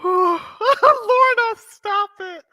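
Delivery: high, loud mock scold; high playful scold; laughing protest. Real, not acted. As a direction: call out stop it, tickled.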